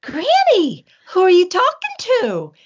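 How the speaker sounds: high, loud, imitated young self questioning Granny; calling to Granny. Retelling asking grandmother about talking to plants, high loud call. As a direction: pipe up loudly, acting out the old question.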